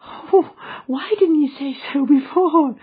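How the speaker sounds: put-on high, loud childlike relief; a small girl glad to join her mother; gleeful little girl. A narrator's reading. Little girl relieved, answering the man gleefully. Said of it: "laughing gleefully".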